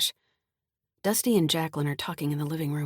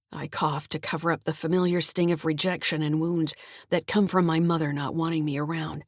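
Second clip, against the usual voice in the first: ordinary delivery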